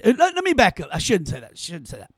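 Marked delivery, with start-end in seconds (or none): 1.5-2.1 much softer repeat. Real, not acted. Host catching himself mid-rant, repeating much softer to himself. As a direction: say it again almost under your breath, much softer.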